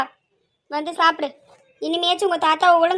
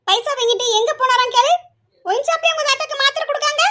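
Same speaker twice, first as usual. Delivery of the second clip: angry; 6 semitones higher than usual. Acted. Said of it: "கோவப்பட்டுட்டு இருக்கா".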